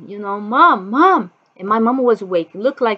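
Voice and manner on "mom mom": calling out for mom. Retold or imitated. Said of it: "I start calling my mom".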